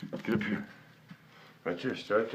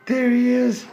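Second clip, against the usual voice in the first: 1 excited high greeting; 10 dB louder, slower than usual. Real, not acted. Finding the cat.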